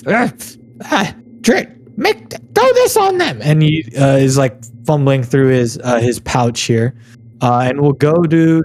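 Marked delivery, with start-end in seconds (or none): 0.1-3.4 high, stammering goblin voice; high-pitched, faltering creature command; flustered goblin boss. Acted. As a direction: squeak out a halting order to a minion. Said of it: "the goblin boss will be like".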